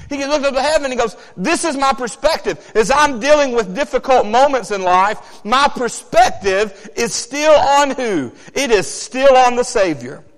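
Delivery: loud, high preacher's cadence; full-force sermon delivery; fiery preaching. Acted. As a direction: preach it with rising fire.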